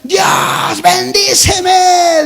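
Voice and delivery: loud, high, stretched-out "bendíceme"; begging shout. Retold or imitated. Sister at the altar begging God loudly for blessing. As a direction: cry it out like a begging child.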